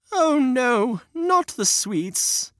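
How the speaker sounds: high, slow, dismayed gasp; scared children. Acted. Children caught by the witch, dismayed. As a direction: wail over the lost sweets.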